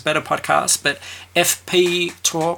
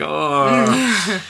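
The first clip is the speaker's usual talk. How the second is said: high, drawn-out "oh" of dismay; anguished groan; 7 semitones higher, 3 dB louder than usual. Retold or imitated. Lying in bed remembering the gory death, anguished groan. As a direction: groan as the memory hits. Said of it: "I suddenly think of it again".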